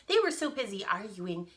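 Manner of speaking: usual speaking voice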